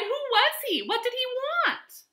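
high-pitched puzzled questions; bewildered cry. A narrator's reading. Animals finding the pig gone, high bewildered cries. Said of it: "the animals cried".

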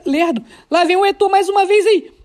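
ordinary talk

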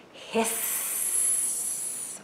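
long, soft snaky hiss; snake hiss. Acted. Triangle tricking Square at the door, long snake hiss. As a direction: hiss it out softly and drawn out. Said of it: "just like a snake".

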